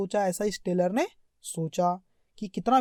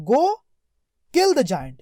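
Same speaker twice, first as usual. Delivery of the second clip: king's command; 5 semitones higher, 6 dB louder, much slower than usual. Retold or imitated. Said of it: "अब राजा क्या बोल रहा है देखो".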